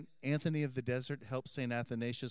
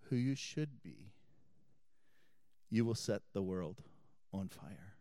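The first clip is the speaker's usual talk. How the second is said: rising call, then hushed to a soft amen; preaching close; 3 semitones lower, 6 dB quieter than usual. Acted. Priest closing his homily to the congregation, fading softly. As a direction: bring the homily home, letting it fade.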